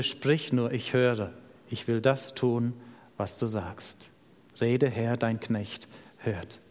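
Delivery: low, soft, reverent reply; hushed, humble answer. Acted. Young Samuel answers the Lord at night, hushed and humble. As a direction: offer yourself quietly, pausing between phrases.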